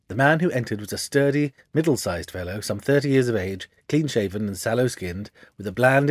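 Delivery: usual speaking voice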